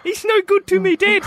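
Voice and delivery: put-on high comic Kiwi accent; Boba Fett. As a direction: put on a high New Zealand voice. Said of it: "'Cause he's a Kiwi".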